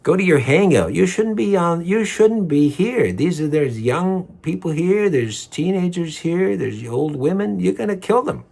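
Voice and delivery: imagined angry rant. Retold or imitated. To the man at Starbucks, an outraged high scolding. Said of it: "In my mind, I'm thinking".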